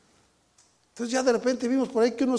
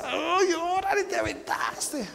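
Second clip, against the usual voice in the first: wife's impressed squeal; 9 semitones higher, slower than usual. Retold or imitated. Wife reacts to his jump.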